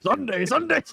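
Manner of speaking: high hyped rally echo; monster truck ad. Acted. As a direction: call it like an arena ad. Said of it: "Monster Truck Rally".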